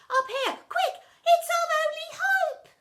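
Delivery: panicked shout. A narrator's reading. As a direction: yell to each other in panic. Kids call out climbing.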